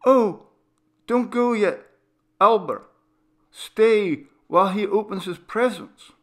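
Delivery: put-on mother urging Albert to stay while the boy opens his presents; coaxing mother. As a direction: hold him back, sweetly insistent. Mother coaxes father to stay.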